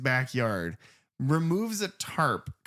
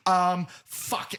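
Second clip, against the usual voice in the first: higher-pitched, flustered stalling; mock flustered panic; 7 semitones higher, 3 dB louder than usual. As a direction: imitate someone caught out and stumped. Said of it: "he's just like".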